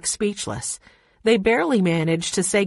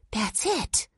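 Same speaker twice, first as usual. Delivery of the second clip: soft, higher, contained excitement; low excited voice; 4 semitones higher, 5 dB quieter than usual. A narrator's reading. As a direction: hush it but buzz with excitement. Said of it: "Jessie said in a low, excited voice".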